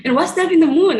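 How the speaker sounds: imitating a small boy gasping at the moon; amazed child. Small boy at the telescope seeing the moon, imitated amazed voice. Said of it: "he was like".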